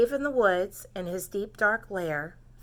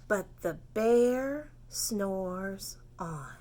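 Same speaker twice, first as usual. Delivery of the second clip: slow refrain; 2 semitones lower, 4 dB quieter, much slower than usual. Shown as a demonstration. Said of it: "This is your part".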